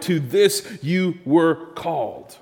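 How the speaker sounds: "To this you were called" slow, each word set apart; emphatic stress. Shown as a demonstration. Preacher repeating the word he emphasized, slow stress on "this" and "called". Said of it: "you probably heard me emphasize that word".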